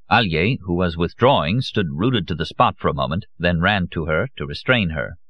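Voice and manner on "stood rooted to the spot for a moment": usual speaking voice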